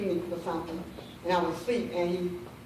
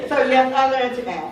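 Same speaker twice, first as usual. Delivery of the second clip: amused; 5 semitones higher, 10 dB louder than usual. Real, not acted. Still amused after laughing.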